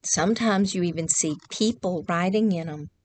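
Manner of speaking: usual speaking voice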